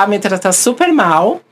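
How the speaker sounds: normal talking voice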